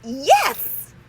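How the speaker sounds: loud, high cheer; triumphant yell. Acted. Brothers cheering their win, loud high cheer. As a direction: shout it in victory. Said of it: "yelled Stanley and Arthur".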